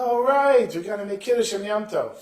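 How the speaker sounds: high-pitched, loud "all right" of concession; giving in. Acted. Friend gives in during argument.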